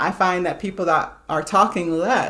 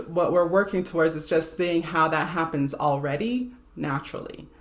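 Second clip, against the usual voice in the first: ordinary delivery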